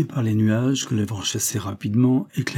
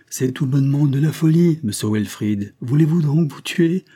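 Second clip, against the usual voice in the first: raised, higher voice of protest; crying out; 6 semitones higher, 5 dB louder than usual. A narrator's reading. Villagers warning Wilfrid off the sea, crying out. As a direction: call out an urgent warning. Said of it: "cinquante voix lui crièrent".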